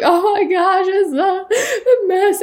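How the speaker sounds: high, laughing-crying wail; mock crying. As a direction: play the breakdown with a laugh through tears. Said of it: "breaking down and crying because I was like".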